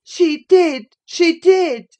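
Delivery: put-on high, loud childish chant; boy's gleeful, jeering sing-song; sing-song taunt. A narrator's reading. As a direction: crow it at her in triumph. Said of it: "sang Linton".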